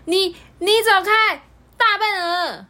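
high, loud yelling; a small one yelling bravely; shouting. Acted. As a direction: shout the goose away. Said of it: "对着鹅大叫".

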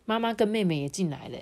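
ordinary delivery

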